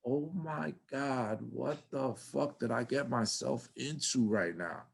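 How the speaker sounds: slow, hushed retold dread; quietly overwhelmed, stretching the first words; mock scared. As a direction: murmur it slowly like someone in over their head. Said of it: "like a little scared cat".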